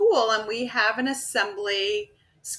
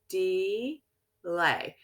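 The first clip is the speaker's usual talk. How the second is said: "delay" very slow, as two separate syllables; split into syllables. Shown as a demonstration. Teacher feels the syllables with hand under chin, slow and split. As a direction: stretch each syllable of "delay" with a gap between. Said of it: "so I can feel those syllables".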